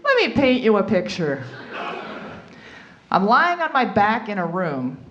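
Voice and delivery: normal talking voice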